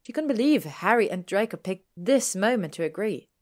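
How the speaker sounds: ordinary delivery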